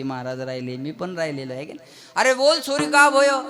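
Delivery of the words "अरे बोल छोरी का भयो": Nanda in Vrindavan Hindi, higher and louder; loud Vrindavan dialect. Acted. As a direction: call out in Vrindavan speech, a notch higher. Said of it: "ही वृंदावनातली भाषा आहे". (वृंदावनी बोलीत, उंच आणि मोठ्याने विचारणे)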